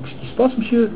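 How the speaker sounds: a hushed, surprised student asking back; whispered student. Retold or imitated. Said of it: "en me disant".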